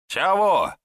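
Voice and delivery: high, loud answering holler; calling back. A narrator's reading. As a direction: shout back to the one who hailed you. Passer-by answers the shouted hail. (громко откликаясь, высоким голосом)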